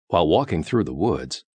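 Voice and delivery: usual speaking voice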